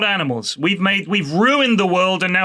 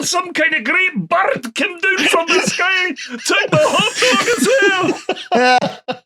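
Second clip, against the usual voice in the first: panicked tourist; 11 semitones higher than usual. Pretend. Hammed-up tourist yelling as a seagull steals the hat. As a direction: shriek like someone robbed by a gull. Acting a frantic seagull victim.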